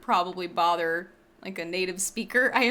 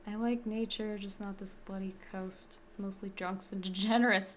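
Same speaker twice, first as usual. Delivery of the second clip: mock Australian accent; 3 semitones lower, 7 dB quieter than usual. Streamer mocking an Australian character's accent, soft mock Aussie. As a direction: parrot the Australian accent back, half under breath. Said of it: "I can't help but continuously mock the Aussiness".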